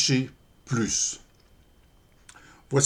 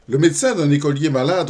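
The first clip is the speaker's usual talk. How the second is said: raised, energetic opening of a verse; poem recitation; 2 semitones higher, 6 dB louder, faster than usual. Acted. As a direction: launch briskly into the poem. Commence la récitation du poème. (récitation de poème, attaque vive)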